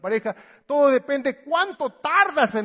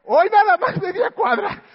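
mock exhausted shout; 10 semitones higher, 8 dB louder than usual. Panting jogger after half block.

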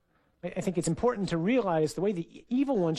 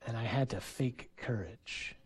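hushed confession; 6 semitones lower, 8 dB quieter than usual. Retold or imitated. Boy's confession retold.

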